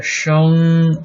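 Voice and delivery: 声 held long, high and level; first tone. Shown as a demonstration. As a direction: draw out 声 loudly on one high pitch. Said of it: "first tone".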